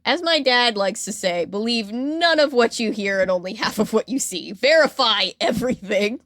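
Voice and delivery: normal talking voice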